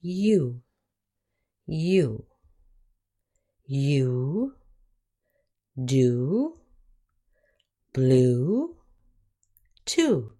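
U as "you", then You, do, blue, two with a long "oo". Shown as a demonstration. Teacher showing the last long vowel, careful long U. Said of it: "The last long vowel sound".

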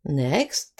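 normal talking voice

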